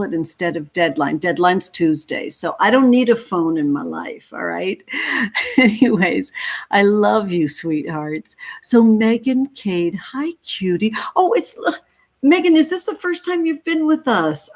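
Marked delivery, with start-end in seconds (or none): 4.9-5.5 quick high giggle at her own joke; a light breathy laugh; short laugh. Real, not acted. Joking with chat about not needing a phone, quick laugh. 11.1-14.5 much higher, thrilled hello to a newcomer; light up at a new face; excited greeting. Real, not acted. Welcoming Megan to the stream, high and excited.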